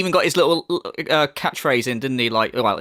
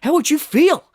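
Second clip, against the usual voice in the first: lightly voiced mock self-pity, slow and higher; mocking imitation; 7 semitones higher, 3 dB louder, slower than usual. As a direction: parody his self-pitying plea. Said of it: "And then to be like".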